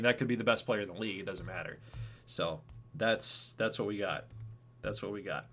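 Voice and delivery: usual speaking voice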